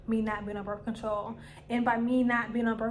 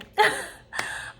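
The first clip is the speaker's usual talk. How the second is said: airy high giggle; 11 semitones higher, 5 dB louder than usual. Real, not acted. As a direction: laugh off the oversharing. Laughs about oversharing.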